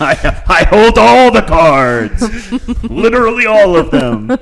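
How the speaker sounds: slow gloating villain laugh; supervillain. Acted.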